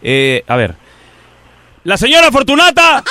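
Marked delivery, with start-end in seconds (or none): 1.8-3.0 grito llamando. Acted.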